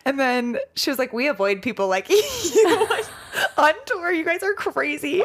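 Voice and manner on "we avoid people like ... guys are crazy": high, laughing teasing quote; laughing tease. Retold or imitated. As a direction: tease her through laughter. Laughing client teases crier.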